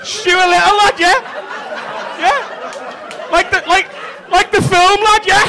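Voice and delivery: high, loud, buzzing delight; excited. Acted. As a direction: sell it like you just got the reference. Crowd work, thrilled at recognition.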